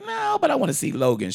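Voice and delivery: squeaky high, fast, pleading voice; high whiny quote. Retold or imitated. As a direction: whine it up high and quick like a kid. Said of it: "And I was like".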